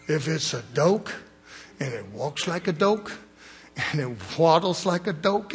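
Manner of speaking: mimicking a north Georgia man saying "doke" for duck; "doke" with a long rounded o, like a Georgia country boy; teasing Southern accent on "doke"; Georgia drawl. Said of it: "That's this redneck southern Georgia boy".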